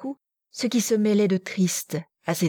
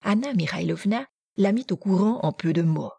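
usual speaking voice

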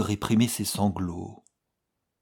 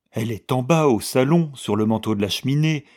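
louder, alarmed reply; 5 semitones higher, 7 dB louder, faster than usual. A narrator's reading. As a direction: answer her, shaken. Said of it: "répliqua-t-il effaré". (réponse effarée, plus forte)

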